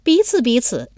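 high voice with a smile in it; playful high girlish retort; laughing young woman. Acted. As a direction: tease him back lightly. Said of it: "第五俊笑道".

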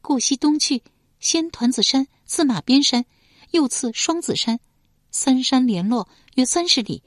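bright guide describing the river; 少女清亮地讲解. Acted. Yan'er gives directions.

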